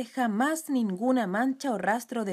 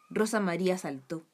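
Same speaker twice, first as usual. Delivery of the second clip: ordinary delivery, nothing marked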